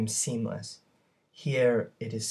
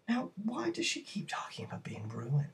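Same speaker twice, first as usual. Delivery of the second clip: whisper; 10 semitones higher, 6 dB quieter than usual. Real, not acted. Teacher poses the question.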